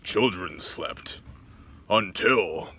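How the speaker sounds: dramatic storybook narrator, swelling loud. Shown as a demonstration. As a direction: build to a booming doom. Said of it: "That's my narrator voice".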